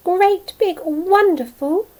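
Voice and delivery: high, loud joyful wonder; excited. A narrator's reading. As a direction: burst out with wonder. Joyful transformation.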